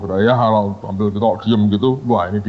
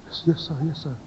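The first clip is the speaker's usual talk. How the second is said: soft, stammering yes-sir; timid acted stutter, barely voiced; mock trembling student; 2 semitones higher, 10 dB quieter, faster than usual. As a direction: play a nervous student answering the boss quietly. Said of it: "wah, sudah gemetar".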